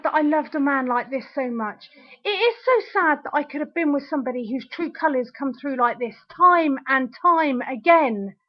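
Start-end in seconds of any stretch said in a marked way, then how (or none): none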